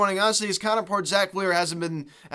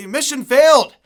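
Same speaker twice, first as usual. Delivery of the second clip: sarcastic loud verdict; 8 semitones higher, 10 dB louder than usual. Real, not acted.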